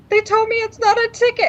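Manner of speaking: high, teary retelling of a phone call to mom; crying on the phone, recreated; retold crying. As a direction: imitate herself sobbing to her mother, pitched high. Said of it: "And I'm crying on the phone".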